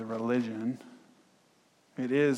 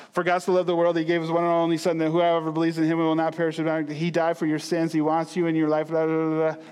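loud, fast, higher rote booklet recital; monotone recital; 4 semitones higher, 6 dB louder, faster than usual. Retold or imitated. Pastor imitates nervous guy reciting booklet, loud and fast. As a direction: rattle it off like reading a script. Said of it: "all monotone".

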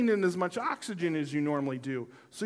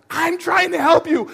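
much higher, loud exasperated wife; wife insisting during their struggle, high and loud; mock wife voice; 13 semitones higher, 15 dB louder than usual. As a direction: play the frustrated helper. Acted quarrel, wife's line.

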